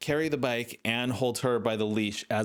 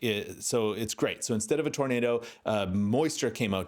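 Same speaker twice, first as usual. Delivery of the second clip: ordinary talk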